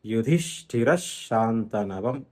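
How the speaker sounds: "యుధిష్ఠిర శాంతనవం" as one slow phrase with a long doubled sh; పొల్లు షా ఒత్తుగా. Shown as a demonstration. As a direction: run "యుధిష్ఠిర" into "శాంతనవం" on a held sh. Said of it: "షాకి ఒత్తుగా మారుతుంది". ("యుధిష్ఠిర శాంతనవం"లో శ్శ ఒత్తి పలకాలి)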